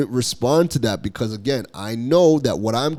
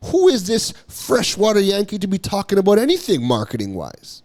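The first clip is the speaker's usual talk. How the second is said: higher, scoffing voice of a doubtful viewer; skeptical newcomer; 6 semitones higher than usual. Retold or imitated. Critic's audience questioning who he is, mock incredulous. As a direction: pitch it up like a sneering stranger sizing him up. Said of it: "your audience is coming over to see".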